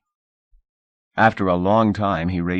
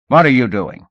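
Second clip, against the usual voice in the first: angry demand; 5 semitones higher, 8 dB louder than usual. Acted. Manager grabs ankle.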